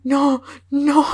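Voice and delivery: high, pleading "no, Vivi"; pleading dismay. Retold or imitated. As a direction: beg the character not to go. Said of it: "yo estaba". (fan pleading with the fainting Vivi, high and distressed)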